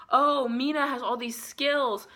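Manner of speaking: mock admiring. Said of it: "it's like".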